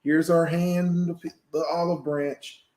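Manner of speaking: high, drawn-out welcoming pitch; mock friendly outreach. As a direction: stretch it out like a company making a peace offer. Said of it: "What a good way to show your hand".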